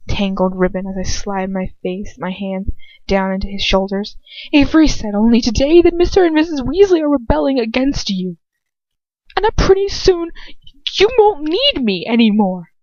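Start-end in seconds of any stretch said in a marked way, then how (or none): 4.5-12.7 high, loud, trembling plea; a girl's voice close to tears. A narrator's reading. Girl confronting her lover, high and trembling.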